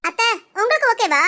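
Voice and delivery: ordinary delivery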